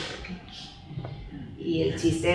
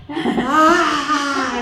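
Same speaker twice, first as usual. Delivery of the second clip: loud, high imitated bawling; exaggerated mock crying at full blast; sister's huge wail; 7 semitones higher, 8 dB louder than usual. Showing how her own sister cried, loud mock wail. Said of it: "mi hermana lloraba".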